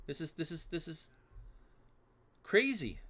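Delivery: ordinary delivery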